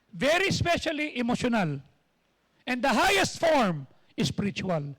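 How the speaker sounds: fiery pastor preaching to the church. Acted. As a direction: drive each point home to the congregation. Preaching at full force.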